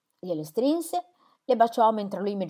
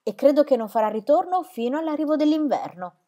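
choked cry; 6 semitones higher, 3 dB louder than usual. Acted. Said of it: "gridai con voce strozzata".

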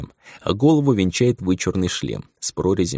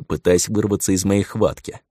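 plain reading voice

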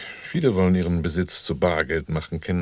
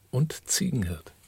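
whispered aside; 5 semitones higher, 4 dB quieter, slower than usual. Acted. Dry joke, unvoiced.

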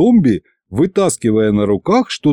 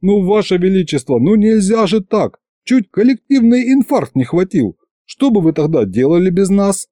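rising reproach; 8 semitones higher than usual. A narrator's reading. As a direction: scold the tsar more and more.

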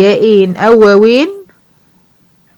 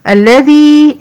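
"الذي" higher and louder, final ي held; stressed الذي; 6 semitones higher, 4 dB louder, much slower than usual. Shown as a demonstration. المعلمة تبرز كلمة الذي بصوت أعلى. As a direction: lift and lean on "الذي" to point it out. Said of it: "نوع الياء هنا إيه؟".

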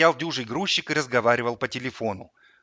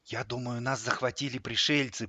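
quiet voice; 8 dB quieter than usual. A narrator's reading. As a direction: say it softly in the dark. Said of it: "произнёс в темноте тихий голос". (тихий голос)

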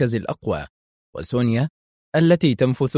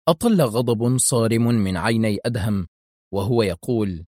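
plain reading voice

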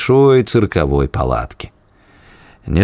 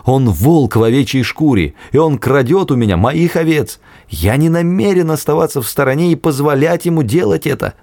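preacher tone; 8 semitones higher than usual. Acted. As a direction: preach it from the pulpit. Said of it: "заговорил тоном проповедника".

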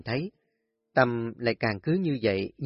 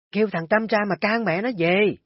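higher and louder urgent shout; 9 semitones higher, 4 dB louder than usual. Acted. Neighbors on the beach calling for help, urgent shouting. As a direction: yell for someone to fetch the son. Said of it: "Mấy người hét lên".

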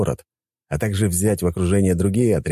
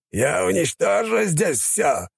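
put-on menacing old mage; 12 semitones higher, slower than usual. Old mage threatens everyone.

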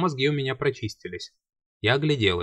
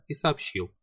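ordinary delivery

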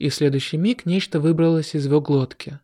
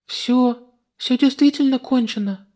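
put-on higher girlish voice; young heroine, relieved; girl's voice; 9 semitones higher than usual. Female character voice. (звонкий девичий голос)

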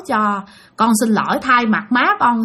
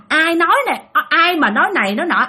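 normal talking voice

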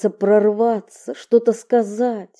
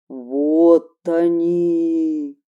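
deep, slow voice from the underworld; 3 semitones lower, much slower than usual. Acted. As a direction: drag it out like a buried god. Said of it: "голосом из преисподней".

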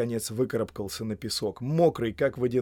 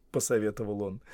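usual speaking voice

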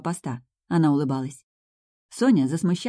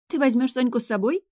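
high-pitched astonished question; taken aback, pitched well up; surprised; 7 semitones higher than usual. A narrator's reading. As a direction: ask in mild disbelief, voice lifted. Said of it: "удивилась Ника".

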